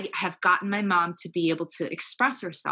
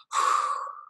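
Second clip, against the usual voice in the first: slow unvoiced exhale to calm down; long calming sigh. Real, not acted. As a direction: breathe out the hurt before answering. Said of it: "I had to take a breathing moment".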